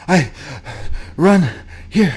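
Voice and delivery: panting between words; out of breath. Retold or imitated. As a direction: imitazione di uno senza fiato. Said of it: "he's out of breath".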